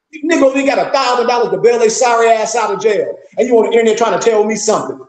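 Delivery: ordinary talk, nothing marked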